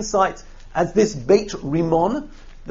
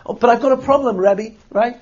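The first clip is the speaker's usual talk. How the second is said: higher, hesitant plea with a hint of nerves; nervous, hesitant; 7 semitones higher than usual. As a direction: sound like a worried man asking the rabbi's leave. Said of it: "he's nervous. He's hesitant".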